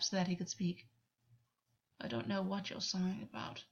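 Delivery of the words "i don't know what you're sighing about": hushed, half-hearted grumble; muted complaint. A narrator's reading. Gwen to sighing August, quietly complaining. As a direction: grumble it under your voice. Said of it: "he complained".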